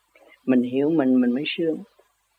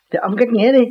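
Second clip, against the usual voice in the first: louder, higher dare; challenging retort; 6 semitones higher, 6 dB louder than usual. Retold or imitated. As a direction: throw the challenge back at him. Lady challenges him. (giọng thách thức, to và cao)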